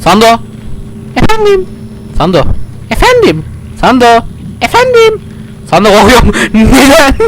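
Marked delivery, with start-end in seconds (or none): 1.1-1.6 high squeaky sidekick answering; cartoon helper voice; squeaky companion voice. Voicing the companion Sando. 2.9-3.4 high squeaky sidekick answering; cartoon helper voice; squeaky companion voice. Voicing the companion Sando. 4.6-5.2 high squeaky sidekick answering; cartoon helper voice; squeaky companion voice. Voicing the companion Sando.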